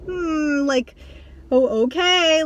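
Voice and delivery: high, drawn-out hesitant okay; uneasy acceptance. Acted. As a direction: play along awkwardly, unsure how to respond. Acts out uneasy reaction to strangers.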